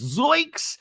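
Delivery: high mock cartoon yelp; playful startled zoinks. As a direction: squeak it like a shocked cartoon. Mocking Gina's shocked face.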